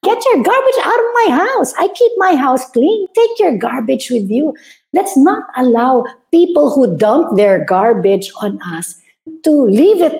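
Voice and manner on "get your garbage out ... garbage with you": much higher, indignant repeat of the order; mock outraged homeowner, higher and sharper; indignant outburst. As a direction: throw it back at them, pitch leaping up. Telling off garbage dumper, escalating.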